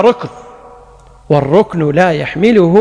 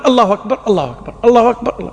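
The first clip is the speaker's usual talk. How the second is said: quick, higher string of takbirs; hurried takbir; 4 semitones higher, much faster than usual. Shown as a demonstration. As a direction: fire off the takbirs, forced to keep up. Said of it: "مضطر". (تكبير متلاحق مستعجل)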